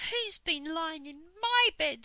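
high, loud, indignant mother bear. A narrator's reading. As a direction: complain loudly as Mother Bear. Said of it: "said Mother Bear".

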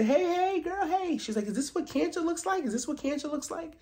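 very high, sugary prying; pry sweetly and high; mock nosy gossip. Mocking Gizelle asking Mia about cancer, very high.

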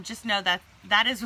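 ordinary delivery

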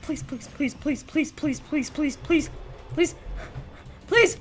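hushed, rapid repeated begging; nervous pleading. Real, not acted. Gamer begging the game to spare them, nervous pleading. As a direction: beg under your breath, over and over.

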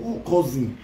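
usual speaking voice

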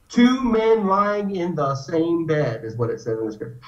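ordinary delivery

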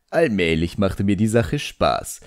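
ordinary delivery, nothing marked

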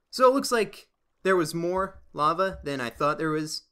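ordinary talk

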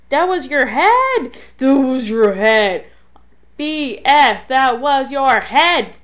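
loud, indignant protest at the game; outraged yell. Real, not acted. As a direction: shout it in disbelief, hammering each repeat. Protests a missed headshot in game.

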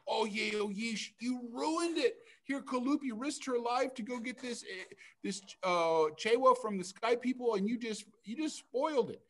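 high, reproachful scolding. Acted. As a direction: tell him off in a high voice. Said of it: "And they said".

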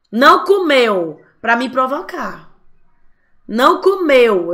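mock wounded mother; offended parent. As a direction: take it personally, aggrieved. Parent taking offense, self-centered.

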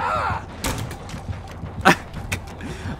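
high, breathless laughing fit; real laugh. Real, not acted. Gamer laughing at the failed play, real laugh. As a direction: laugh helplessly at your own failure.

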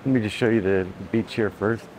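usual speaking voice

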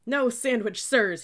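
loud, high, slowed-down yell; screamed reprimand. A narrator's reading. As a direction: scream the correction slowly. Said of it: "he screamed".

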